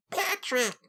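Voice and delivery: high-pitched cartoon character call; SpongeBob. Shown as a demonstration. Streamer attempts a cartoon voice for chat, high mock SpongeBob. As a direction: squeal it in a badly faked cartoon voice. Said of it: "he can't do the SpongeBob accent".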